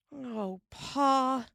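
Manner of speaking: a high wail. A narrator's reading. Mary wailing at Pa's news, high and dismayed. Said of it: "Mary wailed".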